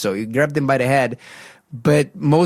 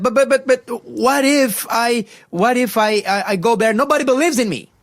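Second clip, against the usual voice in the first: stammering Moses; 10 semitones higher than usual. Retold or imitated. Moses stammers excuses to God.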